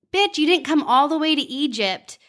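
self pep talk. Retold or imitated. Woman retelling her talk to herself in the pyramid, mock scolding. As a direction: give yourself a sassy talking-to.

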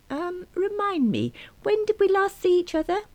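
put-on a fussy elderly caller; old lady caller. Caller voiced high.

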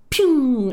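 high, loud "pew" toy-gun noise; imitated gunshot "pew". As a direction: fire off a playful laser-gun sound. Imitates dad shooting the light.

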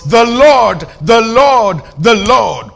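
"The Lord" hit loud and held each time; loud, weighty stress on LORD; emphatic repetition. Shown as a demonstration. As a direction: punch every "The Lord" like a proclamation. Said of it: "my emphasis".

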